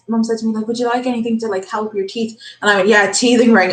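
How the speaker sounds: ordinary delivery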